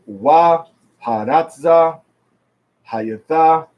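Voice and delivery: slow, separated "Wa Haratza, Hayatha"; "Hayatha" stressed on the last syllable, THA; demonstration reading. Shown as a demonstration. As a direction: read "Wa Haratza" word by word to show the stress. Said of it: "three syllables, goes on the last".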